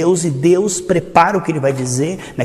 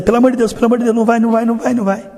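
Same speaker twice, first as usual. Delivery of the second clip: higher, louder desperate begging; mock frantic pleading; 6 semitones higher, 3 dB louder, faster than usual. God pleads with Eve, a mock panic. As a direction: beg in a hammed-up panic. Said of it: "Deus dizendo".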